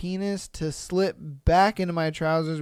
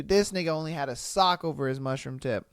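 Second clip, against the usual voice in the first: ordinary talk, nothing marked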